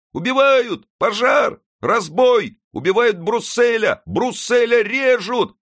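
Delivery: put-on very high, loud boy's falsetto shouts; falsetto yelling. A narrator's reading. Boy Friquet yelling from the mezzanine, shrill falsetto alarm. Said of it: "завопил фальцетом".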